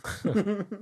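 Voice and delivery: high bursting laugh at a slip; burst of real laughter. Real, not acted. Game master laughing at his own slip of the tongue. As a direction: crack up laughing.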